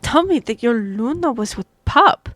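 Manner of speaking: sudden loud alarmed protest; surprised outburst. Acted. Lady Katsuhiko rebuking the Beta, alarmed and loud. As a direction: caught off guard, raise the voice. Katsuhiko startled by pup news.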